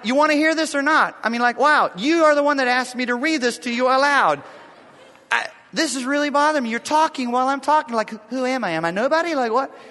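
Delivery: half-playful retold outburst; a hint of a peeved husband; going off. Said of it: "I'm kinda going off".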